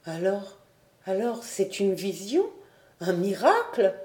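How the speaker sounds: une pointe de stupeur émerveillée, voix de servante légère; vieille servante stupéfaite. A narrator's reading. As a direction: souffler l'étonnement devant un prodige. Geoffrette stupéfaite devant le dessin. (Geoffrette découvre le dessin, émerveillée à mi-voix)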